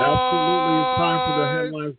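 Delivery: loud, high hype echo; hyped host. Acted. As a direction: pump up the crowd. Host echoes the announcer drop.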